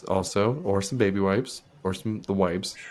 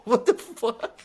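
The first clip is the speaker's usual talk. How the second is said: laughing disbelief; 11 semitones higher, much slower than usual. Real, not acted. Laughing disbelief at own story.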